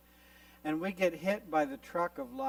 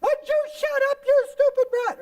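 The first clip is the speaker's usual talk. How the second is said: very high, loud acted screech; screaming angry mom; 9 dB louder than usual. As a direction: scream it like a mother losing her temper. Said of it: "she's screaming".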